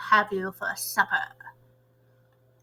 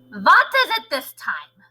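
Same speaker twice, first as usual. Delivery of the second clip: sharp, louder and higher witch shout; 5 semitones higher, 6 dB louder, slower than usual. A narrator's reading. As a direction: bark it at the door like an angry witch. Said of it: "shouted the Grand High Witch".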